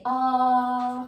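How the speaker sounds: loud open ahh demo; saying ahh. Shown as a demonstration. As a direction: hold a long clear ahh. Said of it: "Now can you say".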